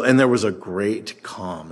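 ordinary talk

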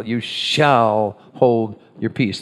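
"shall" stretched out long and slow; slow right down, leaning on "shall"; stress on shall. Shown as a demonstration. Pastor repeats the verse for listeners, stressing "shall". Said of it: "I emphasized the word shall".

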